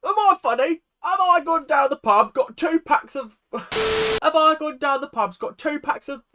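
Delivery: high, loud parody British pub bloke; over-the-top British comedian bit; mock British accent. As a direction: belt out a hammed-up British accent. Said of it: "their depiction of British people is wholly inaccurate".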